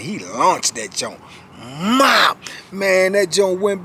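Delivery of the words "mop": loud, high imitated horseshoe whoosh; thud sound effect. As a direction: mimic the throw. Horseshoe thrown in story.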